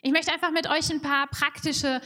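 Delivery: ordinary talk, nothing marked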